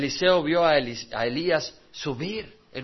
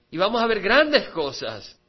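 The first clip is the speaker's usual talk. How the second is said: loud, driving preacher's exhortation; full-force preaching; 5 semitones higher, 6 dB louder than usual. Acted. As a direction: preach it out to the congregation. Predicación a plena voz. (predicación a toda voz)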